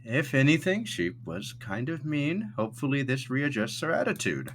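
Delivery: high, mildly disapproving host; Thatch. Acted. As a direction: pass judgement in a lifted, measured character voice. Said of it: "Thatch goes".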